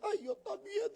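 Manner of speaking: high, soft mock shy reply; mock timid voice. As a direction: squeak it like a shy follower joining in. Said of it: "se quedan callados y dicen".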